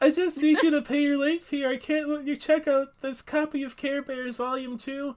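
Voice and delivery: high-pitched customer-service plea; put-on young store worker; teenage clerk. Voicing clerk asking for late fee.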